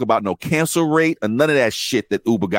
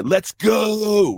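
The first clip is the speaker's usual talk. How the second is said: higher pushy call to hurry; rider's aggressive text read out, mock pushy; mock aggressive demand; 9 semitones higher than usual. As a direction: bark the text like an angry rider. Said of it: "it's getting really aggressive".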